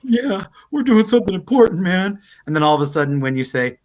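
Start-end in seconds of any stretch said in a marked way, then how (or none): 0.0-2.2 high, choked-up crewman voice; mock teary-eyed. Crewman moved by the captain's speech, mock teary-eyed. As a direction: play a henchman comically moved near tears. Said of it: "kinda getting a little teary-eyed".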